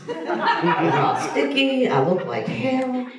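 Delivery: dismayed. Retold or imitated. Waking up after the trip.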